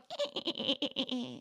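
lightly voiced witch cackle; a hint of a cackling witch. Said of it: "Not the sound effect".

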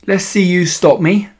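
a hint of a bratty bully; taunting boy. A narrator's reading. Bully daring the narrator.

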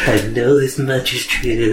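slow, wobbly pop-singer imitation. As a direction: drag it out in his odd crooning voice. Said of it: "where he's going, with his weird voice".

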